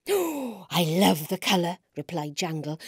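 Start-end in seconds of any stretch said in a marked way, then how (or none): 0.1-1.8 slow, drawn-out admiration; lightly voiced admiring character; admiring friend. A narrator's reading. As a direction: gush slowly over it. Jangle admires the new boots.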